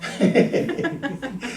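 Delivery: risita suave y más aguda; reírse bajito de sí mismo; risa suave. Real, not acted. Ríe tras su asombro. (se ríe de su propia sorpresa, bajito)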